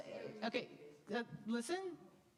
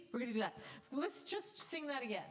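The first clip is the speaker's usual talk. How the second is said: usual speaking voice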